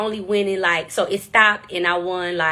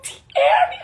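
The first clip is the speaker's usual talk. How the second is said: excited shout. Retold or imitated. Shouting her name excitedly.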